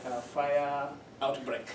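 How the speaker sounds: ordinary talk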